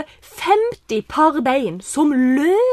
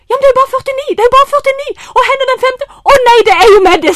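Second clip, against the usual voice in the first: flustered alarm; 11 semitones higher, 8 dB louder, faster than usual. Retold or imitated. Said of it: "sier jeg".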